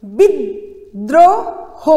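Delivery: বিদ্রোহ stretched out very slowly, syllable by syllable; slow drawn-out demo. Shown as a demonstration. Teacher showing pupils how to pronounce, very slow. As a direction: spell out বিদ্রোহ slowly for the class. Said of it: "জফলাকে দুইভাবে লিখতে হবে".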